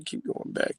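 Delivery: breathy whisper while scrolling back; under his breath, searching; whispered to himself. Real, not acted. Whispering to himself while searching the list.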